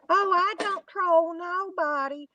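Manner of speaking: high, slow mocking old-lady voice; mocking the troll. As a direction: mimic her, high and drawn out. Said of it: "one minute she's saying".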